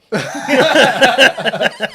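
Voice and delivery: high, loud burst of laughter; big laugh. Real, not acted. Reacting to the "clink me" joke, loud high laughing.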